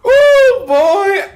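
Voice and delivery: high shrieking laugh breaking into a loud whoop; howling with laughter, then a loud high ooh; real laugh. Real, not acted. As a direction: cackle high and loud at his own joke. Cracks up at own roast.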